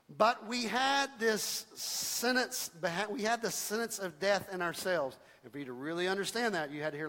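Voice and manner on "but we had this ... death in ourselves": slow scripture reading. Shown as a demonstration. Slowly reading the verse aloud.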